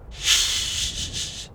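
whispered shush; hushing. A narrator's reading. Boy hushes the girl, whispered shush. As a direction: hiss a quick shush. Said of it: "The boy hushed".

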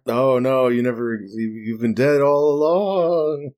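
slow eerie reveal; drawn-out ghost story tone; spooky voice. Acted.

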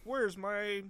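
half-voiced impression of an entitled customer; mock demanding customer. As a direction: pitch it up like a diner making demands. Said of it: "I wasn't Andre Powell customer".

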